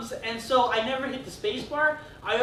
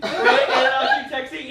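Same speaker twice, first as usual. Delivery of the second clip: slow words through real laughter; laughing; 3 semitones higher, 8 dB louder, much slower than usual. Real, not acted. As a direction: laugh while you say it. Laughing at his own story.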